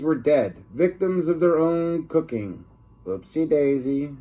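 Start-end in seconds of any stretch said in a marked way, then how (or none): 3.0-4.2 lightly voiced careless janitor; a mild offhand oops. Janitor finding the dead lunch ladies, mild oops. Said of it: "said the janitor".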